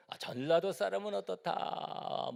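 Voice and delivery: high, slow mock gossip line; lightly voiced gossiper; quoting regional gossip. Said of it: "뭐 이런 얘기도 하고".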